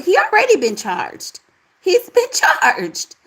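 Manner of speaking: high-pitched incredulous insistence; worked-up, disbelieving rebuttal; emphatic correction. Real, not acted. Exasperated correction of a viewer's comment.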